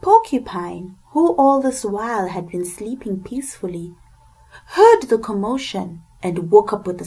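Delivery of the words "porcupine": ordinary delivery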